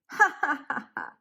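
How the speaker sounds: high, loud, staccato ha-ha jeer; mocking laughter. A narrator's reading. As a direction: act out a crowd laughing at Noah. Other people mock Noah.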